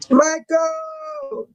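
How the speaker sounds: high, sing-song call of "Michael"; high playful call. Real, not acted. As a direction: hail him across the room. Calling out to chatter Michael.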